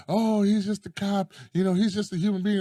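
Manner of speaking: imitating pleading online defenders; mock commenters. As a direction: parody their excuse-making, voice lifted. Mimicking people in the comments.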